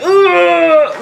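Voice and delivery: drawn-out "ugh" of pain; pained groan. Retold or imitated. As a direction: imitate groaning through a contraction. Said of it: "then it would be like".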